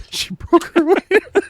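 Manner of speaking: high, drawn-out words through laughter; real laughter. Real, not acted. Friend echoing a movie joke, laughing hard. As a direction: crack up while repeating the punchline. Repeats punchline while laughing.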